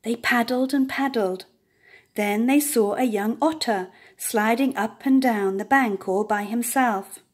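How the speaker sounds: ordinary delivery, nothing marked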